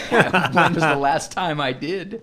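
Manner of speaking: slow, laughing through the words. Real, not acted. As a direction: crack up while asking. Laughing reply.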